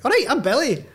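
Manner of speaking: imitating Billy Connolly's cheery hello. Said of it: "and he's like".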